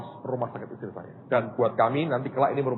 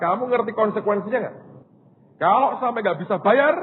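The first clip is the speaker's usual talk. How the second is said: higher, louder warning to his wife; husband warning his wife she could be held at hospital, raised and urgent; 8 semitones higher, 6 dB louder than usual. Retold or imitated. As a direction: press her hard on the risk. Husband warns wife about risk.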